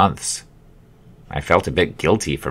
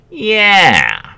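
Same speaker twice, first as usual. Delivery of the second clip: ad slogan yeah; 13 semitones higher, 4 dB louder than usual. Acted. Customer acting out slogan.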